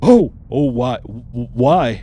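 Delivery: loud, alarmed and confused; startled ogre. Acted. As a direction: jump back in surprise.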